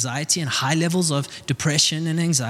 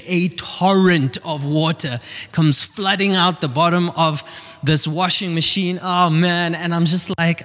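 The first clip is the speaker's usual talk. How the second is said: normal talking voice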